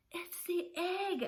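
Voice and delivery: high, slow gasping announcement; boy out of breath telling Pop; breathless boy. Acted. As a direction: get the news out between breaths. Breathless boy tells news.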